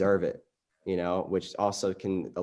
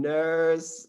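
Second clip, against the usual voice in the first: high drawn call for a nurse; imitated call; 8 semitones higher than usual. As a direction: mimic a patient calling out. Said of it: "the way you're calling".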